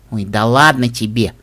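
low, dismissive scoff; dismissive snort. A narrator's reading. Sister waves away her brother's objection, scoffing. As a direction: brush it off with a scoff. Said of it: "фыркнул Диди".

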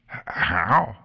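stammered, gasping question; gasped. A narrator's reading. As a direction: gasp it out in disbelief. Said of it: "Mortar gasped".

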